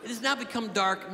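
ordinary talk, nothing marked

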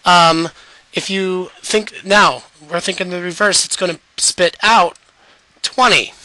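normal talking voice

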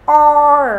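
loud, held "or" with curled R; R-coloured vowel. Shown as a demonstration. Teacher isolating the sound for learners, long loud "or". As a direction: sustain "or" strongly into the R. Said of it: "Chúng ta sẽ có từ door".

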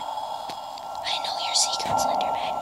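slow, unvoiced whisper; hushed taunt to a monster; creepy whisper. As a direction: whisper it slowly, as if sharing a secret.

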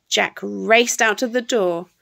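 ordinary delivery, nothing marked

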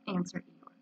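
plain reading voice

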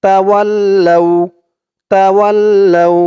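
তাওয়াল্লাউ drawn out with doubled ল্ল; held ল্ল in তাওয়াল্লাউ; slow recitation. Shown as a demonstration. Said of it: "লাম ওয়াও জবর লাউ".

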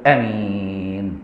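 آمين slow, low and exaggerated to mock; mimicking an odd long آمين, low and slow; mock drawn-out amen. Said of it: "فيقف وسط الناس يقول لك".

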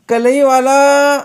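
long-drawn, high, loud vendor call; hawker's street cry. A narrator's reading. Girl playing at tinsmith, loud hawker's cry. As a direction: holler it out slowly, stretching the vowels. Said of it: "अशी आरोळी मारली".

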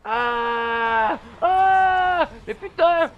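loud, high panicked screaming; jump-scare screams then a yelled curse; scared screams. Real, not acted. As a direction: scream in real fright. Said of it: "Comment ils m'ont fait flipper".

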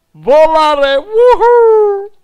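loud, high cheering whoop; excited flying whoop; joyful whoop. Real, not acted. Flying around in game.

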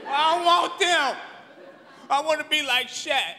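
a hint of a high whining kid; whiny kid. Kid refusing cheap shoes, mildly whiny. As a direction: whine it like a demanding child. Child begging for gym shoes.

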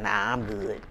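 low, quiet, flat brush-off; low flat refusal. Retold or imitated. Turning down friends' invitations, low and quiet. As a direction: drop it low and uninterested.

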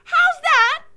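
very high, loud yell; shouting Mig. Acted. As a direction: bellow it back, half deaf. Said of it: "shouted Mig".

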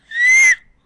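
high, loud gasp of fright; sharp startled gasp. Real, not acted. Gamer startled by something in the level, sharp gasp. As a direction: gasp as if jumped at. Sudden scare in game.

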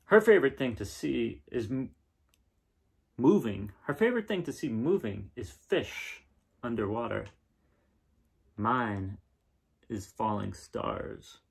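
gentle storybook reading, a bit brighter; reading to children. Acted. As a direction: read the picture book aloud to kids. Reading picture book aloud.

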